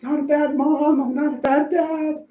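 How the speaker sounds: a hint of the embarrassed parent insisting; lightly voiced flustered parent; defensive parent. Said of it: "kind of feel".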